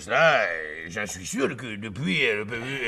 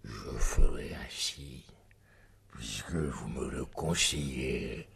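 whisper; 9 semitones lower, 6 dB quieter than usual. Acted. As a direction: breathe it secretly to the lord. Secret exchange, hushed reply.

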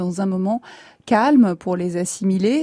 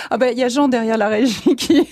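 usual speaking voice

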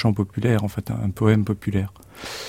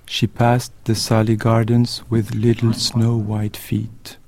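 very slow, measured poem reading; poem recitation; much slower than usual. Acted. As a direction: recite the verse slowly, lingering on each phrase. Reads Yeats poem aloud. (récitation lente d'un poème)

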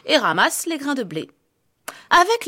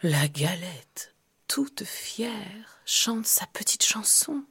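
whispering; 5 semitones lower, 8 dB quieter, slower than usual. A narrator's reading. Whispered narration.

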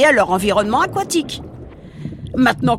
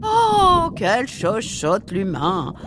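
mocking; much slower than usual. Mocking the squeamish human.

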